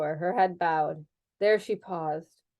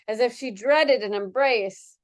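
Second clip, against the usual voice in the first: plain reading voice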